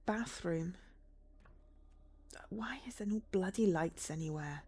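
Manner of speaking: hushed whisper in the dark; creeping along whispering. Real, not acted. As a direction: breathe the words like someone might hear. Creeping through a dark house.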